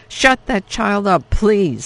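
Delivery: fed-up stranger in the bomb cellar; snapped. Acted. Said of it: "someone snapped".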